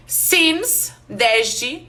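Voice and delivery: ordinary delivery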